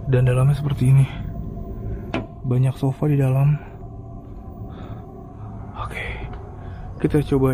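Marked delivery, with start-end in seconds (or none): 5.8-6.2 whispering. Real, not acted. Sneaking into an abandoned building.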